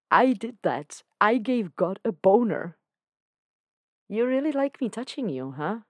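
a touch of proud wonder; amazed. Acted. Dan marvelling to Lucifer at what he did, mildly giddy.

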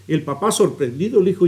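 usual speaking voice

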